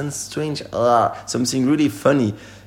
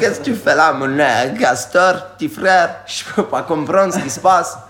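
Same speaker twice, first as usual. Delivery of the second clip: lightly voiced old Cajun man, louder; a touch of an old bayou man, raised voice; 5 semitones higher, 4 dB louder than usual. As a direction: play a hint of an old Cajun fellow calling out. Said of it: "the old one".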